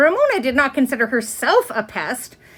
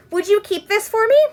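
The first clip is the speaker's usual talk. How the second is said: put-on high, hopeful little-girl voice; a child politely asking her teacher to keep her tooth; small girl asking; 9 semitones higher, 4 dB louder than usual. Ramona asks teacher.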